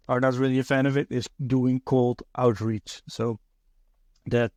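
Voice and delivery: usual speaking voice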